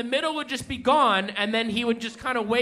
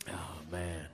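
Doctor Pepper's set; 12 semitones lower than usual. Retold or imitated. Doing a friend's stand-up set verbatim for the crowd, deep and weary. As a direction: play a tired burnout comedian, much lower. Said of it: "I'm gonna do his set verbatim".

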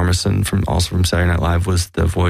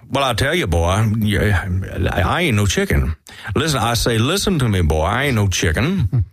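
higher-pitched Southern cartoon rooster, swooping up and down; put-on blustering rooster voice; Foghorn Leghorn; 3 semitones higher than usual. Shown as a demonstration. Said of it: "let's hear Foghorn Leghorn".

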